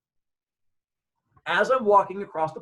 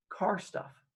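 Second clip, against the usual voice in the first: soft, slightly high, hesitant reply; evasive answer; 4 semitones higher, 7 dB quieter than usual. Retold or imitated. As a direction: offer it quietly after a long pause. His evasive answer to police.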